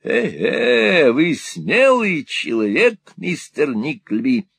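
put-on high, wheedling old miser voice; fawning old moneylender. Old usurer fawning on Ralph, high and wheedling. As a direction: simper it like a cringing usurer. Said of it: "воскликнул тот".

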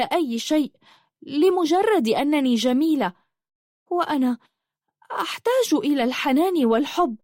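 high-pitched, pained lament; sorrowful. A narrator's reading. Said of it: "وقالت بأسى". (بأسى وحزن، بصوت مرتفع الطبقة)

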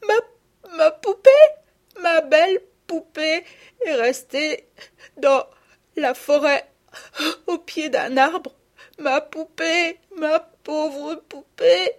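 voix aiguë et forte, hachée de sanglots; sanglots d'enfant. Acted. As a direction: une petite fille qui pleure sa poupée. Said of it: "recommença à sangloter". (sanglots d'enfant aigus et forts)

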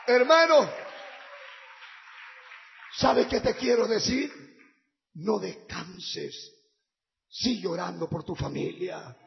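loud, fervent sermon with shouts; fiery preaching. Acted. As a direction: preach it out to the crowd. Predicación a pleno pulmón. (predicación ferviente a gritos)

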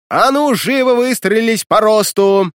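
громко, выше и медленно; рявкнуть как командир на строй; командный голос. A narrator's reading. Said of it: "заорала командным голосом Варвара". (громкий командный окрик)

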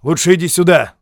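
громко и резко бросить; коротко приказать громким голосом; бросил. A narrator's reading. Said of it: "бросил Моррис". (капитан резко зовёт сержанта)

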